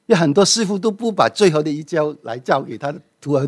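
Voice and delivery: ordinary talk, nothing marked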